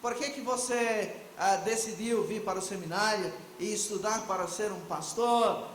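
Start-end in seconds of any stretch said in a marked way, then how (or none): none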